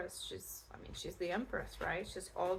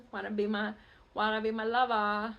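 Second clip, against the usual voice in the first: hammed-up silly lover voice, louder at the end; mock come-on, leaning loudly into "lover"; silly suitor; 5 dB louder than usual. Reader jokes as the returning admirer, silly put-on suitor voice. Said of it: "it's just me being, like, silly".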